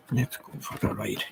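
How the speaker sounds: soft unvoiced whisper. Real, not acted. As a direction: breathe the words without voice. Repeats the line, second whispered.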